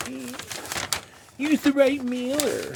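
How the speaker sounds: high, loud whiny complainer. Pretend. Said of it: "I can hear the whiny bitch in my voice".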